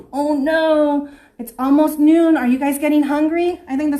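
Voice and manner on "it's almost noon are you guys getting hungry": plain reading voice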